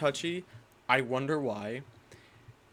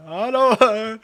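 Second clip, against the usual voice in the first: put-on high, loud Indian-accented lemur king; King Julian; 8 semitones higher, 7 dB louder than usual. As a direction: greet like a cartoon lemur king, pitched up. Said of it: "It sounded like King Julian".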